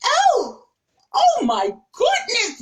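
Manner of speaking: high, pleading cry of fright; a scared girl crying out oh my goodness; frightened cry. Retold or imitated. Young [name] cornered by bullies cries out in fright. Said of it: "And I was like".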